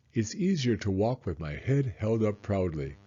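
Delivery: usual speaking voice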